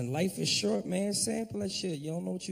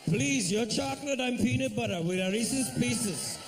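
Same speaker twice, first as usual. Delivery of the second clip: higher-pitched mock Indian accent, imploring; pleading Indian man; 4 semitones higher than usual. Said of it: "all of a sudden we the same".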